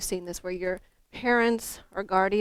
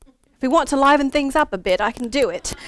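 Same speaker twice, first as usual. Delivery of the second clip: playful British accent, higher and louder; 6 semitones higher, 10 dB louder than usual. Shown as a demonstration. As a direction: slip back into a childhood British accent. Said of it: "back then I had a British accent".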